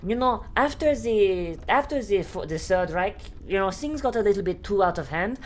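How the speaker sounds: mock German voice, easing into the pitch; German accent. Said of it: "over in Germany, do you just have".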